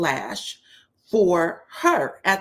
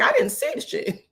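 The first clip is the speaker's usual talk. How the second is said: much higher, playful mock protest; mock squeaky innocence. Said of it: "basically Shamia's like".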